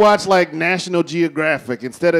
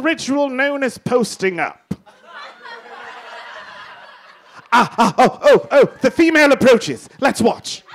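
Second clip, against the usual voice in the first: higher British narrator voice; plummy documentary host, marvelling; nature documentary; 5 semitones higher than usual. Acted. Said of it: "My name is Sir Reginald Holoferlum".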